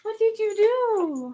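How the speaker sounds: high, slow, drawn-out question; real pet-talk swoop; cooing to a pet. Real, not acted. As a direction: stretch it out as if talking to a dog. Fussing over hurt dog.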